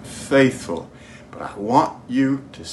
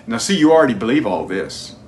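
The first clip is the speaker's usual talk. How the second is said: normal talking voice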